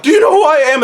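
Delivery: high, loud indignant challenge; mock arrogant demand. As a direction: play the entitled soldier pulling rank. John Walker confronting prisoner.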